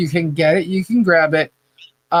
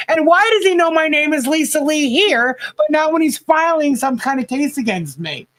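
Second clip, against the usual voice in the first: higher, baffled retelling; pitched up in disbelief; incredulous; 9 semitones higher than usual. Retold or imitated. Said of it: "I'm like".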